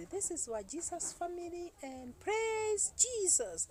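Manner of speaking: high, loud, drawn-out show greeting; imitating a channel's high-pitched catchphrase; YouTube family intro. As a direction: sing out a channel intro, stretching the praise. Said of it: "I used to watch them".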